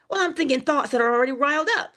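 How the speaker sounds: ordinary talk, nothing marked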